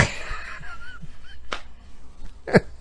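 high breathless tickled laugh; preacher too tickled to go on; high silent laugh. Real, not acted. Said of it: "I'm getting too tickled".

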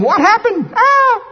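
high surprised mock shopper; startled shopper. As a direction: gasp in surprise. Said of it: "People come up going".

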